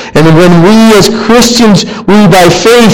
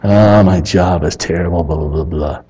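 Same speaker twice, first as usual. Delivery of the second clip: low, soft grumpy complaint trailing into blahs; hammed-up low grumble; mock grumbling; 11 semitones lower, 8 dB quieter than usual. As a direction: imitate a sulky complainer, low and under the voice. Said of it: "Grumble. Complain".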